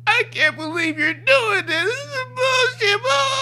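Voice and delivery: very high, slow, drawn-out bawling; mock wailing. Driver protesting her ticket to the cop, mock high wailing. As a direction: wail it like a hammed-up tantrum. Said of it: "she start wailing down crying".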